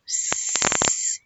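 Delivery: a long sustained "s" hiss; isolated s sound. Shown as a demonstration. Teacher showing how six begins, drawn-out "s". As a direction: hold "s" as a pure sound, no vowel. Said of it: "What sound can you hear at the beginning of six?".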